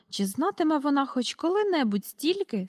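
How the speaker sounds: ordinary delivery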